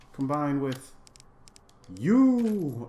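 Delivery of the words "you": high, loud, stretched-out vowel; odd drawn-out whoop. Real, not acted. Player combining items in the game, goofy high whoop. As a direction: swoop up and hold the word loudly. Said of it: "why'd I say it like that".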